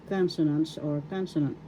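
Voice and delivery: ordinary talk, nothing marked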